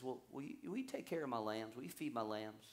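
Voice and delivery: soft, low, tender asking; hushed, low-voiced gentle charge; gentle request. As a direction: ask it quietly and kindly. Jesus gently asks Peter, acted.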